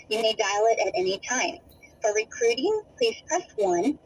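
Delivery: automated phone menu voice; recorded phone message. Real, not acted.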